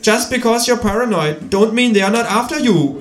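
high-pitched creature voice in English; quoting kangaroo. Acted. Kangaroo quoting [name] to the narrator, high knowing voice. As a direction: deliver the lyric as a high-voiced quip.

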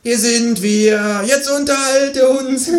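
slow, high and demanding; kangaroo voice. Acted. Kangaroo demanding a show, slow and high. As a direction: stretch it out high like a bored patron.

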